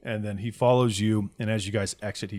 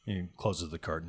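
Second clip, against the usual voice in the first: ordinary talk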